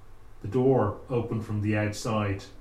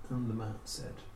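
ordinary delivery

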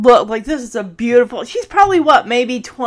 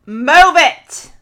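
loud snapped order, Kate shooing the men; sharp command; 2 semitones higher, 7 dB louder than usual. Retold or imitated. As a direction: bark it at them to clear out. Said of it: "She's like".

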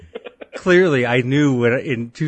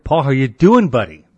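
loud greeting; 9 dB louder than usual. Real, not acted. Greeting guest loudly.

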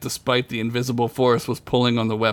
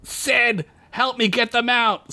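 high, pressing shout; urgent calling; 11 semitones higher, slower than usual. A narrator's reading. Fred calls Sid to evacuate friends, urgent shout. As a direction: call a friend to help immediately.

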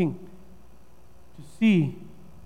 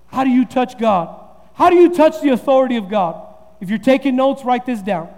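ordinary delivery

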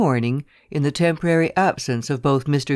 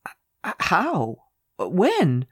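stammering, breathless gasp, a little higher; 4 semitones higher, much slower than usual. Acted. Lawyer gasping questions at the secretary in shock. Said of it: "I gasped".

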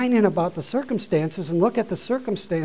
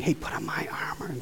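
whispered, much softer and lower; God quietly inviting the believer, in a whisper; God's hushed invitation; 3 semitones lower, 8 dB quieter than usual. Retold or imitated. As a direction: lean in and whisper the offer. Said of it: "and God's saying".

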